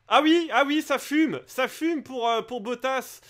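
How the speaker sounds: alarmed shout as the engine smokes; excited. Real, not acted. Smoke spotted on Bottas's car. (commentateur excité, voix aiguë et forte)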